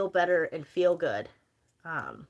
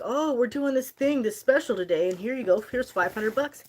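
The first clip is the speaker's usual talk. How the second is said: content creator voice; 6 semitones higher, 6 dB louder than usual. Retold or imitated. Imitating the content creator surprising the lady, bright and loud. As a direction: pitch it like a hyped prank-show host. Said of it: "he's like".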